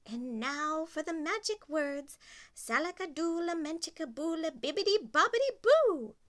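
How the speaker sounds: spell incantation. Acted. Fairy godmother casting a spell, building to a loud high boo. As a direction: build the magic words to a flourish.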